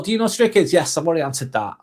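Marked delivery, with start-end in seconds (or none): 0.0-0.7 high chat read. Retold or imitated. Streamer reading a viewer's question aloud, high and light.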